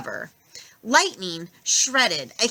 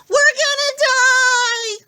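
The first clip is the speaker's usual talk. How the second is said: high, loud, slow panicked cry; panicked wail; 7 dB louder, much slower than usual. A narrator's reading. Passengers wail in panic, high and slow. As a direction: wail in terror. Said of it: "flailing, going".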